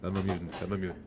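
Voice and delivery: low, very soft villain murmur. Acted. Trying out the line as Magneto, low quiet villain voice. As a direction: drop low and quiet, self-serious. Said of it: "[name] as, as Magneto".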